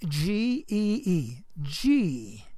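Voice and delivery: slow, drawn-out spelling; careful letter-by-letter spelling; spelled out slowly. Shown as a demonstration. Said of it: "And that word is a G".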